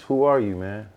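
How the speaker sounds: ordinary talk, nothing marked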